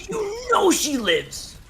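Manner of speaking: high, slow cry of disbelief; exasperated outburst. Real, not acted. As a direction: drag out the obvious in frustration. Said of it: "He's, he's so worked up".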